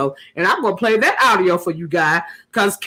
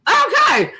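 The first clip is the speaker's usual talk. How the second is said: sassy okay; 13 semitones higher than usual. Real, not acted. Sassy retort to abuser.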